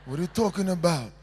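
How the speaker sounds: quiet, low, dismissive mumble; mock Italian gesture talk. Showing students the Italian brush-off, low and quiet. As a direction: shrug it off in a low voice. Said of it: "It's like this".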